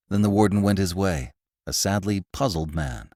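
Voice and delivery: ordinary delivery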